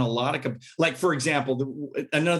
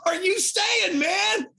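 much higher, half-crying disbelief; shocked high shout; much slower than usual. Retold or imitated. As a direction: cry it out in shock. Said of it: "And I'm like".

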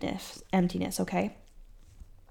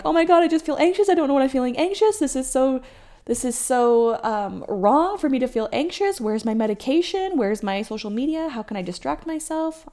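fast, higher, hammed-up anxious rush; freaking out; 5 semitones higher than usual. An anxious woman freaking out before her period, fast mock panic. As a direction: rattle it off like a mock panic attack. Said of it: "instead of freaking out".

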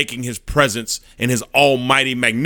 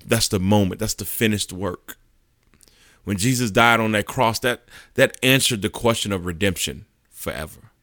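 ordinary talk

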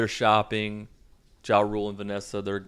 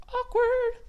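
very high, squeaky falsetto; singsong awkward. Acted. Commenting on the awkward silence, very high falsetto. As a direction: pipe it out in falsetto. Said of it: "it's just awkward as shit".

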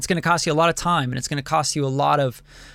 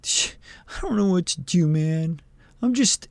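mocking a lost young artist; 2 semitones higher than usual. Mocking young producers who feel lost after five projects, lightly whiny. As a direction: poke fun at a confused beginner. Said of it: "and then you're like".

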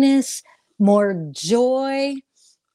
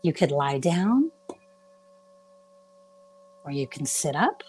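slow, soothing meditation guide with long pauses; guided meditation. Real, not acted. Guide asking listeners to close their eyes and relax, guided meditation. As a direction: ease them gently into relaxation, drawing words out.

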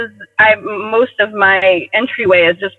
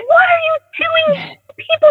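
alarmed partner. Retold or imitated. Partner seeing her strip by the Vermont water, mock alarmed. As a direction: panic at her jumping in. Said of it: "And he's like".

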